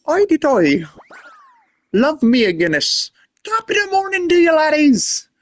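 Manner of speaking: high, fast stage-Irish brogue; hammed-up Irish accent. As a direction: ham up a cartoon Irish lilt, Irish accent throughout. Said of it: "Irish originally".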